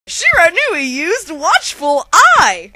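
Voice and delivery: loud, slow game-announcer call; Pokemon battle announcer. Acted. Narrator parodying a Pokemon battle, announcer voice.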